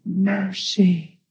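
soft mercy. Retold or imitated. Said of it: "The Lord said".

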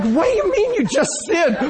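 high-pitched imitation of disbelief; incredulous wife. Wife demanding what her husband's confession means, incredulous.

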